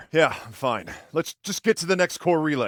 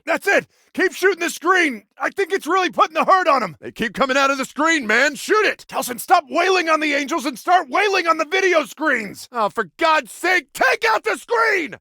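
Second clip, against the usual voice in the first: high, loud combat yell; exasperated yelling mid-battle; urgent shouting; 12 semitones higher, 3 dB louder than usual. Acted. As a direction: shout frantic orders over a fight. Shouting orders mid-fight.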